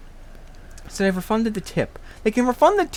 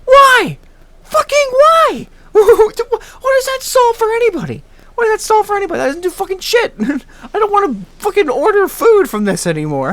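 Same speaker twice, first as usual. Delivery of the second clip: high, loud, exasperated yelling; furious outburst; 12 semitones higher, 7 dB louder than usual. Real, not acted. Customer swearing off food delivery, furious outburst. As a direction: wail it in outraged disbelief.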